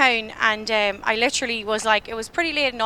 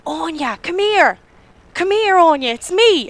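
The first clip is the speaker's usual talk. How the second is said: calling brother; 5 semitones higher, 6 dB louder than usual. Retold or imitated. Brother calling her from ahead.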